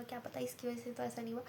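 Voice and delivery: softly wondering aloud; quiet musing. Shown as a demonstration. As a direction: drop the voice and muse on क्या पता इसकी वजह से. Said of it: "repeated line".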